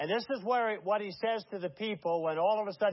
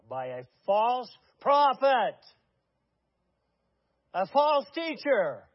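higher, louder and slow, each word hammered; denouncing; 7 semitones higher, 8 dB louder, slower than usual. Real, not acted. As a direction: thunder out the accusation. Preacher denouncing Jim Jones.

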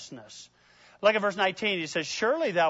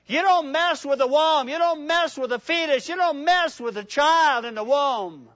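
loud, high preaching build; fiery preaching; 6 semitones higher, 4 dB louder than usual. Acted. Preacher thundering at the congregation about the womb.

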